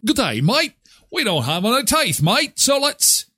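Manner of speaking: high-pitched mock British-Australian accent; Australian-sounding accent. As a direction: put on a hammed-up Aussie "mate" accent. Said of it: "maybe that's a little Australian".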